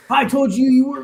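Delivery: loud, high mock furious yell; Andy Luck tearing into him; imitating Andy Luck yelling. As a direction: bark it out like an angry official. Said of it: "Andy Luck style".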